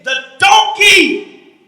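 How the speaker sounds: slow, loud preacher shout; fiery preaching. Acted. Preacher shouting to the congregation about the donkey, fiery. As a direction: hammer it home from the pulpit.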